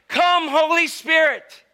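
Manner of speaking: slow, high, loud summons; booming invocation. Acted. Evangelist cueing the hidden boy, booming invocation. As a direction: call it up to the rafters. Evangelist calls the cue to rafters.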